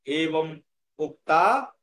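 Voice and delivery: এবং উক্তা drawn out, lifting at the end; slow recitation model. Shown as a demonstration. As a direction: stretch the opening words as a cue for pupils. Teacher models verse opening.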